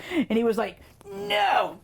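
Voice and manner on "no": very high-pitched mock-furious refusal; furious toddler. Toddler to his returning dad at the airport, furious. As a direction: imitate a little kid's angry protest. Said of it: "he was furious".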